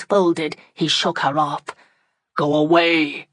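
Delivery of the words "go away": deep voice. Acted. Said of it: "said he in his deep voice".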